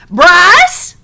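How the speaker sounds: high, loud shout of a name. A narrator's reading. Old woman yells at the boy, loud high shout. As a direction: yell his name sharply. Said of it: "shouted the old woman".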